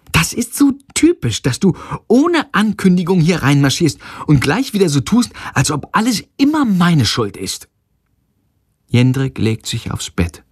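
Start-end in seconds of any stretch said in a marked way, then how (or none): 0.1-7.7 high-pitched, indignant outburst; worked-up accusation in an argument; exasperated quarrelling. A narrator's reading. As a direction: let the grievance pour out in a raised pitch. Acted quarrel, mother rails at ex.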